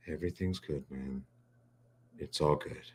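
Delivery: imitating a listless friend; depleted of energy. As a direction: sound like the life drained out of him. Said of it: "Pulper was all excited".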